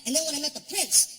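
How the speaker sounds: usual speaking voice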